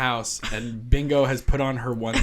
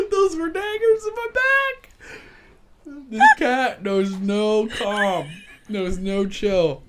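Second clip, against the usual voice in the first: pained mock drama; 9 semitones higher, slower than usual. Real, not acted. Cat digs claws into her.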